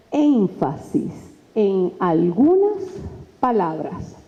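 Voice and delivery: slow, word by word; deliberately slow emphasis. Shown as a demonstration. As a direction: stretch each word for emphasis. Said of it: "hablo un poquito más lento".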